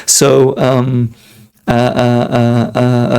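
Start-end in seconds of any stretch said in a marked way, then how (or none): none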